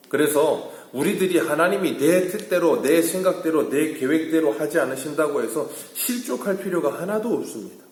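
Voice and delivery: high, loud pulpit delivery; forceful preaching. Acted. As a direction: drive the point home to the congregation. Preacher exhorts congregation. (목소리를 높인 힘찬 설교조)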